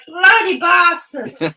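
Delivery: slow, loud drawn-out mock curse. Reader cursing the writers for the open ending, slow and loud mock outrage.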